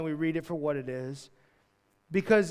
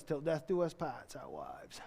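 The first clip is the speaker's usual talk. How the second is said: softer imitation of a Maine accent; thick Maine accent; 3 semitones lower, 8 dB quieter, slower than usual. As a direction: repeat it in broad Maine speech. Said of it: "with a thick Maine accent".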